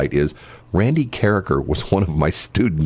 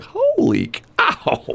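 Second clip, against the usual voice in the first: very high, slow, stunned exclamation; much slower than usual. Retold or imitated. Reacting to the woman's tearful plea, high slow astonishment. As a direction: let it out in disbelief, drawn out. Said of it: "I'm going like".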